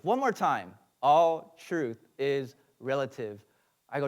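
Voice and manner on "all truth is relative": slow, separated words. Retold or imitated. Customer asked to repeat it one more time, slowly. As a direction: say it again word by word, drawn out. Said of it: "repeat that really slow".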